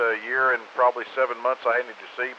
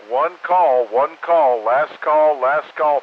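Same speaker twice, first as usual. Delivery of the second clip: drawn-out, louder last call; 3 semitones higher, 7 dB louder, much slower than usual. Real, not acted. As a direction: call it out over the air. Net controller's final call.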